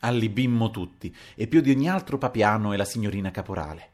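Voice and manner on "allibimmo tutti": plain reading voice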